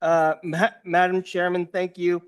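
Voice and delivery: talking around ice in the mouth. Real, not acted. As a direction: mouth full of ice, words a bit thick. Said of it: "sorry, I have ice in my mouth".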